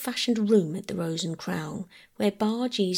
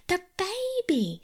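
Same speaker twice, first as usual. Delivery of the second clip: mild scream; 12 semitones higher, slower than usual. A narrator's reading. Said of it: "screamed Bobby".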